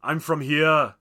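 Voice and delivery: put-on loud, heavy Austrian accent, drawn out; Arnold Schwarzenegger. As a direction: belt it out as an Austrian action star. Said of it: "it's like Schwarzenegger".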